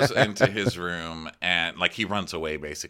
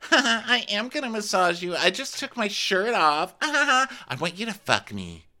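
high, breathy-free mock seductress voice, pitched way up; seductive Ashley. Host mocking Ashley seducing Gary, high mock-sultry. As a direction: camp it up as the forward woman.

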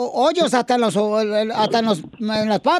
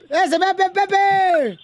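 higher, excited call of a name; 6 semitones higher, much slower than usual. Real, not acted. Radio host calling his guest Pepe to the mic, lively call. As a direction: hail the guest across the studio.

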